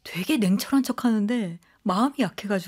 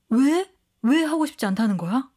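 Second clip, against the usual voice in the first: incredulous demand; 3 semitones higher, 3 dB louder than usual. Acted. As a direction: press for a reason, dumbfounded. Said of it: "막 따져 물어요". (어이없다는 듯 따져 묻기)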